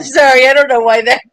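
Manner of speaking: laughing apology. Real, not acted.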